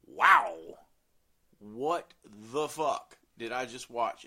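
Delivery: stunned amazement. Retold or imitated. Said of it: "you're just sitting there like".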